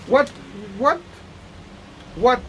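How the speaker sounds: ordinary talk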